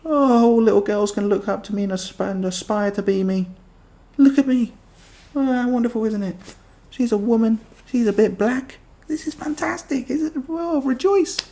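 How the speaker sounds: high, sing-song mock praise; mocking gushing woman. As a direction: parody a self-congratulating celebrity.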